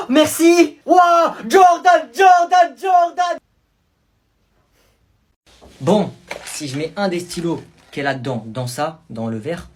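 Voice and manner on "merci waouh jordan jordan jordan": shrieking high cheer for Jordan; excited cheering. Acted. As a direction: scream his name like a fan. Students cheer arm-wrestle win.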